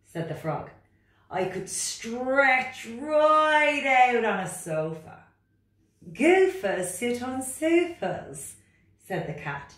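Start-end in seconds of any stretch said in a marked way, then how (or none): 1.3-5.2 high, loud, protesting frog voice; reluctant frog. Acted. Frog character. 6.1-8.6 loud, high, bossy cat voice. Acted. Cat character.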